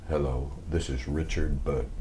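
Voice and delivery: lightly voiced deep, soft phone greeting; a hint of a low, quiet star introducing himself; Richard Burton. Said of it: "and it was".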